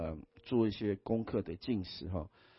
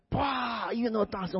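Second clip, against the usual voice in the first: shocked wow; 11 semitones higher than usual. Retold or imitated. Recalling shock at loud music.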